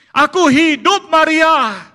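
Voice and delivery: high, loud gotcha announcement; spring it on her like a prank; hammed-up surprise shout; surprise reveal.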